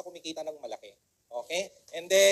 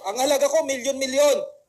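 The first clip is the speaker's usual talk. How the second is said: loud and higher, declaring your worth; proud affirmation; 5 semitones higher, 7 dB louder than usual. Shown as a demonstration. Motivational speaker leading audience, loud proud affirmation. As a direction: proclaim it like a mantra. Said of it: "Sabihin nyo sa sarili nyo".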